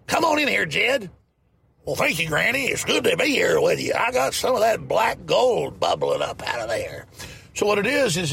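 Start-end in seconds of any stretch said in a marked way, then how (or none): 0.1-1.1 high hillbilly granny call; high-pitched mock hillbilly twang. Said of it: "She talked like Granny from the Beverly Hillbillies". 1.8-7.1 hammed-up country hillbilly accent; hillbilly reply. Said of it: "Granny from the Beverly Hillbillies".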